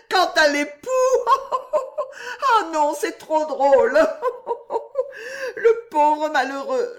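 she can't stop laughing at the idea of a husband. Acted. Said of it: "s'écria Juliette en riant toujours".

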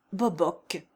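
plain reading voice

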